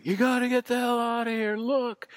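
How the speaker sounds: high, urgent panicked yell; frantic warning shout. Retold or imitated. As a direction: urge them to flee now. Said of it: "a pounding on my door from my neighbor".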